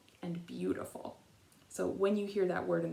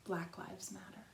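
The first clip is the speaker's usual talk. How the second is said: whispered; 11 dB quieter than usual. A narrator's reading. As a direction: let it sink to almost a whisper. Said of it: "When we whisper".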